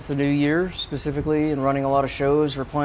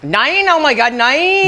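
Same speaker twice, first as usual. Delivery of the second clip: put-on bubbly sorority girl; 11 semitones higher, 5 dB louder, slower than usual. Attempts German Valley Girl.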